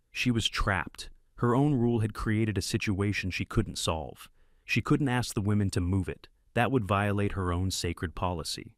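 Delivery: ordinary delivery, nothing marked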